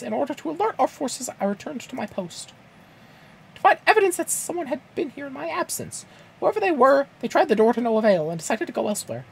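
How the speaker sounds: very high cartoon falsetto reading; squeaky mascot giving a long report; Mickey Mouse. Guard briefs adventurer on footprints, Mickey Mouse impression. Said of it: "interrupt your Mickey Mouse reading".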